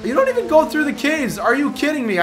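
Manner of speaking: high, outraged complaint; can't believe what the race did; indignant disbelief. Real, not acted. Route skips the caves.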